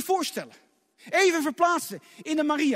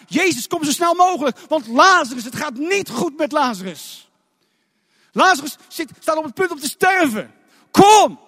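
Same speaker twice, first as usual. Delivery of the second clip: louder, higher urgent call for Jezus to come; dramatized urgent plea from Maria's sisters; 4 semitones higher, 7 dB louder than usual. Acted. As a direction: plead loudly and urgently.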